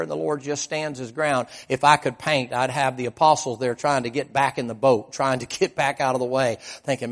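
ordinary talk

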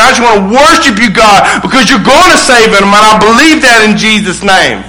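impassioned, driving prayer to God; fervent prayer. Acted. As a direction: pour out a preacher's insistent prayer. Acts out a believer's fervent prayer.

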